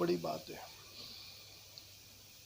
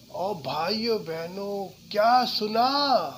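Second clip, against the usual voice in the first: louder, slow rally-speech style; a light imitation of the prime minister addressing crowds; Modi; 5 semitones higher, 8 dB louder, slower than usual. Teacher lightly imitating Modi addressing a crowd. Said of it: "जैसे मोदी जी बोलते हैं".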